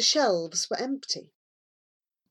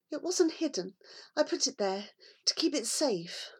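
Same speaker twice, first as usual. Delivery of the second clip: softer, half-whispered reply; soft frightened girl; 6 semitones higher, 6 dB quieter than usual. A narrator's reading. Girl answering the matron, soft whispered reply. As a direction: hold back tears.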